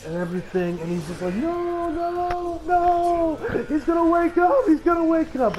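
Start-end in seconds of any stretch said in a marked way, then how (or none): 1.3-5.6 high, whining cry of denial; losing it. Retold or imitated. Acting out a character refusing to accept a death, high tearful wail. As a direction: imitate someone sobbing in denial, pitched high. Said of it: "he's just losing it".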